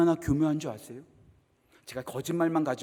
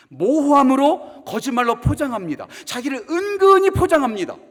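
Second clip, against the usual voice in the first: loud, rising pulpit warning; fiery sermon delivery; full-force preaching; 9 semitones higher, 12 dB louder than usual. Acted. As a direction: hammer home the warning like a preacher.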